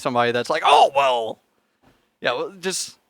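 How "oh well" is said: mock reaction. Mimics a hypothetical offended viewer.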